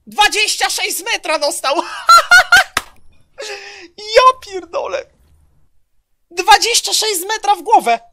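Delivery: high, loud, near-screaming hype; crying out in stunned excitement; hit hard crying out. Real, not acted. Hype over a huge headshot.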